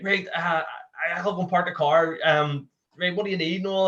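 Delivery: playing his own panicked self at the hospital drop-off; flustered husband. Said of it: "and I, I was like".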